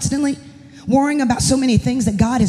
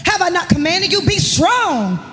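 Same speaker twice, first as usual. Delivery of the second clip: full-force preaching; 5 semitones higher than usual. Acted. Pastor's wife preaching God's command to Joshua to the church, full-force preaching. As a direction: proclaim the verse with rising fervor.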